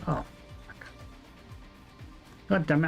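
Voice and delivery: usual speaking voice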